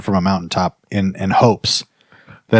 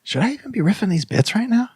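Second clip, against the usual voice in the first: inner doubt; 10 semitones higher, 8 dB quieter than usual. Retold or imitated. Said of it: "in your head you might be like".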